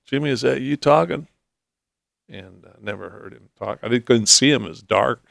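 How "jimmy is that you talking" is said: higher, louder sudden surprise; as if hearing a mute friend speak; startled question. Retold or imitated. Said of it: "and I go".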